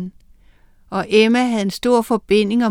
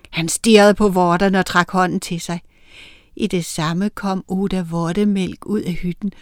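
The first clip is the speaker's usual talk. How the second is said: plain reading voice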